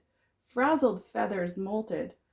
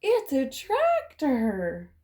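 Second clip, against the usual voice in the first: alarmed cry; slower than usual. Acted. Chicken realises it is a tractor, alarmed cry. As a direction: announce the danger in dismay.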